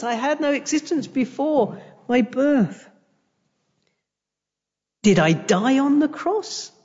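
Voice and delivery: normal talking voice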